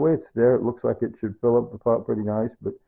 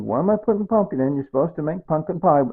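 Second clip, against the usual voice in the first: imagined objector, pitched higher; questioning viewer; 6 semitones higher than usual. Acted. Cook mimics a viewer questioning the pumpkin, higher-pitched objection. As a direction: act out a puzzled objection in a higher voice. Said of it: "And you think".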